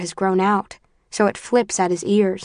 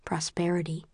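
soft, voiceless single word; whispered; 10 dB quieter than usual. A narrator's reading. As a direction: breathe the word out with distaste. Narrator repeats the motto bitterly.

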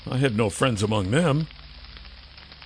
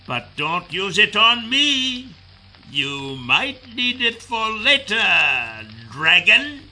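slow, mocking, higher villain voice; sneering villain; 7 semitones higher, slower than usual. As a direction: drawl out the taunt with contempt. Kryzak continues taunting.

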